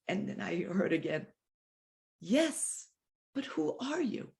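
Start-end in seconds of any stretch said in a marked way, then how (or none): 2.2-4.3 higher, soft and sweet; hushed tender question. Retold or imitated. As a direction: gently press the question once more. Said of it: "the voice said sweetly".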